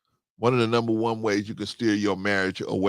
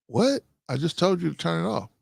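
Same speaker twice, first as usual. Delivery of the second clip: indignant what; 7 semitones higher than usual. Retold or imitated. Husband's indignant retort retold.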